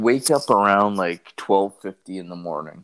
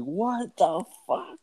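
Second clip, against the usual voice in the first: high drawn-out disbelief; 11 semitones higher, slower than usual. Retold or imitated. Retold shock.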